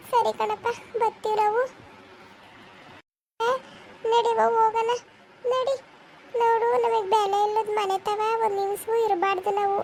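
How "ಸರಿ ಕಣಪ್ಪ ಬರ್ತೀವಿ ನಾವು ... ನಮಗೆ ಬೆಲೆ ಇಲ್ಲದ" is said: hurt and leaving, choking up; tearful goodbye. Acted. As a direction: voice breaking into a cry. Mother leaves hurt and rejected. (ಅಳುತ್ತಾ ವಿದಾಯ)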